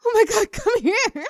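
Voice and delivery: high, loud, laughing burst of joy; overjoyed laughing. Acted. To the crush after the yes, high laughing joy. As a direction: spill over with delight and pull them close.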